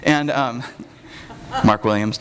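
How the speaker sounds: ordinary talk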